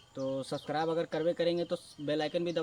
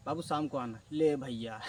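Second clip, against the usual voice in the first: mimicking the shopkeeper; 5 semitones lower than usual. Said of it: "तो क्या बोलता है".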